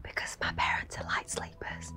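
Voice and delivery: hushed phone whisper. Acted. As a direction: whisper so parents stay asleep. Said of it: "Why are you whispering?".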